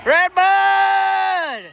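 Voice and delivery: loud, high call across the track; shouting. Real, not acted. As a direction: belt out the name. Shouting track name outdoors.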